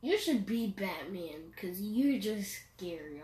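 ordinary talk

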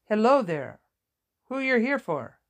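put-on surprised nurse, lightly Vietnamese-accented. A narrator's reading. Nurse greeting an unexpected visitor, Vietnamese accent. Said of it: "said in a thick Vietnamese accent".

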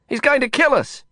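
high-pitched panicked protest; alarmed cry. Acted. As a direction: cry out as if about to crash. Panicked passenger in speeding cab.

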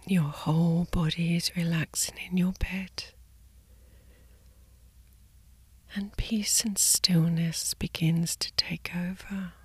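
soft slow sleep whisper; soft spa whisper. Acted. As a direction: whisper them gently to sleep. Guided sleep meditation body scan.

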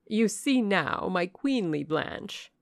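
a mother gently warning her proud daughter; Lady Ingram. Acted. Lady Ingram warns Blanche, slow and high. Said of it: "began Lady Ingram".

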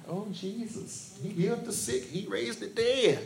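softly murmured gossip; quiet awed murmur; hushed crowd murmur. Retold or imitated.

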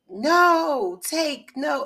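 high, loud replay of a desperate cry; imitated panicked scream. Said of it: "And I'm hearing screaming".

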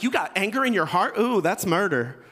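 loud, teasing accusation with a laugh; mock preachy scolding. Husband teasing wife in bed, loud mock scolding. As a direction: scold her like a preacher, half joking. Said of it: "And I was like".

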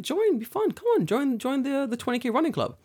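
high-pitched playful pleading; wheedling, high mock enthusiasm; eager coaxing. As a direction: coax your brother in a high voice. Retelling his coaxing of brother.

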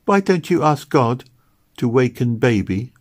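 put-on a small boy asking innocently; a hint of a young child's voice. A narrator's reading. Child Robin voiced in story.

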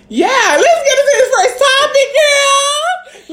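high, laughing, almost sobbing; laughing crying. Real, not acted. Starting the first topic, high cackling laugh.

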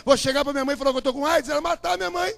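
loud, driven sermon testimony; preaching at full force. Acted. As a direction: hammer each line to the crowd.

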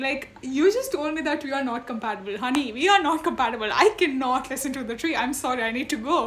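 acted exasperated refusal, quick and half laughing; laughing protest. Woman retells refusing to listen to a tree, laughing protest. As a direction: rattle off a mock protest through laughter.

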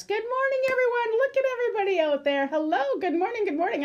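high, bright, bubbly hello; excited greeting. Real, not acted. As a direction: greet the viewers with bursting excitement.

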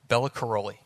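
usual speaking voice

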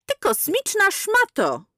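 high, slow, spat-out curse; enraged woman cursing; furious insult. A narrator's reading. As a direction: hurl the insult at her. Sister-in-law yells abuse.

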